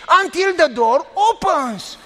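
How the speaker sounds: each word of "Until the door opens" drawn out slowly and pressed; slow spelled out. Retold or imitated. Pastor repeating for the woman who missed it, slowly. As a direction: spell it out slowly for someone who doesn't get it. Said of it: "You don't know English".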